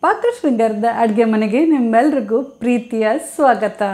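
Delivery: usual speaking voice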